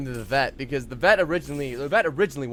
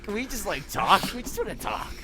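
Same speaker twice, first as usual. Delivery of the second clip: gentle plea; 6 semitones higher than usual. Acted. Acting out Canada's gentle intervention.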